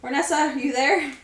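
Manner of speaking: calling out. Acted. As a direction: call out for a missing companion. Player calls out to companion.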